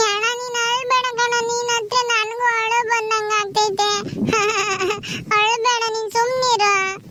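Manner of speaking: crying little sister. Acted. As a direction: sob while consoling your brother. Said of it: "ನಿನ್ ತಂಗಿನೂ ಅಳ್ತಾಳೆ". (ಅಣ್ಣನನ್ನು ಸಮಾಧಾನಿಸುತ್ತಾ ಅಳುವ ಧ್ವನಿ)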